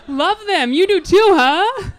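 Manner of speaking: loud, high, overeager reply; eager fake enthusiasm. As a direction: gush to sell the lie. She lies eagerly.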